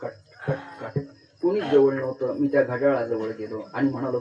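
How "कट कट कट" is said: soft clock ticking. Shown as a demonstration. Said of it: "तुम्हाला शांत आवाज आला".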